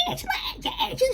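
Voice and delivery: high laughing squeal of Angela, Angela; mock her frantic calling with a laugh; imitating a friend's frantic calls through laughter. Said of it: "And she's going".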